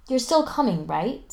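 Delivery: nervous check with a hopeful lift; a worried ask to his friend; anxious. A narrator's reading. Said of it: "Spencer asks anxiously".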